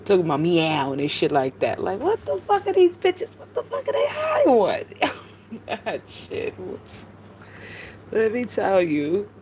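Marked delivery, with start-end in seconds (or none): 2.0-4.8 very high falsetto disbelief; incredulous squeal. Real, not acted. Baffled outburst after bizarre story. 5.7-7.1 voiceless, soft laughing words; too amused to get the words out; breaking into laughter. Real, not acted. Cracks up after her outburst.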